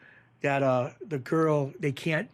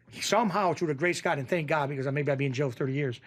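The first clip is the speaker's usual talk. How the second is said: usual speaking voice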